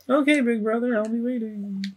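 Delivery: a lightly voiced little sister. Petunia answers her big brother, high and small. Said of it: "I don't know what her voice should be".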